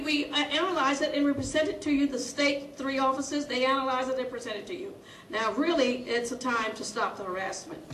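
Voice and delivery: ordinary talk, nothing marked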